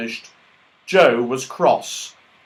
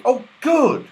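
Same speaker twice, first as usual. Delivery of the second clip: delighted; 13 semitones higher, 6 dB louder than usual. Acted. Franny pleased, thought.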